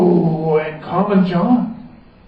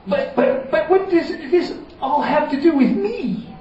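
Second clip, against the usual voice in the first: protesting wizard; 10 semitones higher than usual. Acted.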